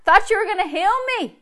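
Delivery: high, loud, rushed cry to the Lord; retold crying. Sick teenage boy crying out to the Lord, pleading. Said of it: "And then he'd start crying".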